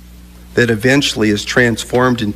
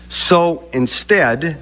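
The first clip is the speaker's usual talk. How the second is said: said very slowly; 3 semitones higher, much slower than usual. Shown as a demonstration. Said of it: "I want to say this very slowly".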